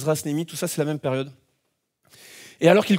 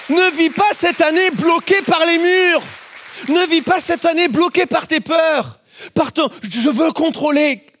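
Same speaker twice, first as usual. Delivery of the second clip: fervent preaching; 6 semitones higher than usual. Acted. Preacher urging the congregation to let go, fervent. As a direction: exhort the church with full conviction.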